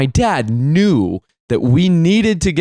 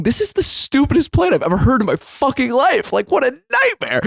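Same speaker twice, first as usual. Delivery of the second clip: high-pitched, exasperated inner rant; retold outburst, strained up high in disbelief; 12 semitones higher than usual. Son silently cursing dad's plan, exasperated.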